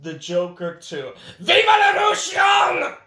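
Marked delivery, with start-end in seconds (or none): 1.4-2.9 high, loud mock battle cry; big shouted slogan; rallying shout. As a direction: yell it like a revolutionary.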